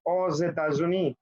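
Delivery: "aux États-Unis" with z joining the words; liaison with Z. Shown as a demonstration. Teacher gives a vowel-initial example, pronounced with z liaison. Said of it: "Pronuncio la Z".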